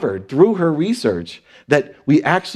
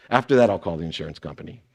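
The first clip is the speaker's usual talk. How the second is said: quick aside; 8 semitones lower, 7 dB quieter, faster than usual. Real, not acted. Punchline aside.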